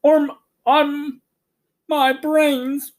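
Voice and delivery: high, wailing scarecrow voice. A narrator's reading. Scarecrow wailing to Oz about his brains, high and tearful. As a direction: wail it like a sobbing straw man. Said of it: "wailed the scarecrow".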